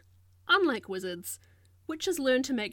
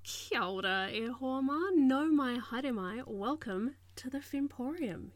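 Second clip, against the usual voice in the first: ordinary talk, nothing marked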